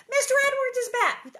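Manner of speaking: very high, loud exclamation; excited shouting. Acted. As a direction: shout the big news in delight. Excited reaction to character's return.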